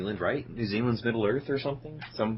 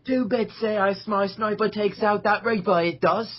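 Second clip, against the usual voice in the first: mock Cockney; 10 semitones higher than usual. Cockney bit continues.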